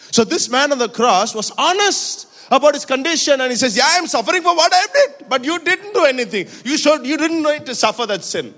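high, loud preacher voice driving the cross story; preaching at full force. Acted. Preacher to congregation, telling the cross scene at full force. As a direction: pour it out to the church at full volume.

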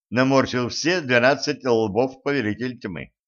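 plain reading voice

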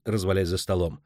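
ordinary delivery, nothing marked

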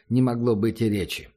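ordinary delivery

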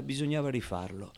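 ordinary delivery

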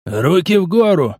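barked order from behind; a mock soldier's shout from behind; loud command. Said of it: "раздался громкий голос".

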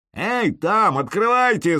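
loud call up to the wall; shout. A narrator's reading. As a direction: yell to open the gates. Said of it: "заорал я".

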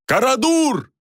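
very high-pitched drawn call; yelling. A narrator's reading. Jorian calls his companion from the rope, urgent yell. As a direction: holler the name urgently. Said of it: "заорал он".

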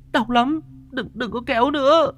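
high, wailing plea through sobs; crying in pain. A narrator's reading. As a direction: beg through tears, hurting. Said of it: "nó vừa khóc vừa mếu máo".

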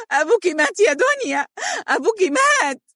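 mother screaming the news in tears; screaming in grief. Acted. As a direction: cry out the death in anguish. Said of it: "وهي تصرخ بها".

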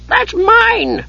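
very high-pitched possessive squawk; old wizard's shriek. A narrator's reading. Old wizard grabs for his handkerchief, shrieking. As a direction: shriek it as a cranky old man. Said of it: "he shrieked".

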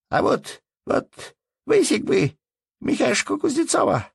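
put-on very high, childish piping voice; little boy. A narrator's reading. Little boy telling father whom he would thrash, childish boast. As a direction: play a small boy boasting.

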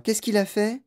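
put-on high, lively boy's question; eager question. A narrator's reading. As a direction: ask keenly, impatient to hear. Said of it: "demanda vivement Charlie".

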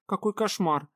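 high dismayed cry; exclaiming. Acted. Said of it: "воскликнула она".

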